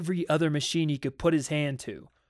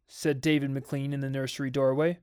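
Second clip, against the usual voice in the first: ordinary delivery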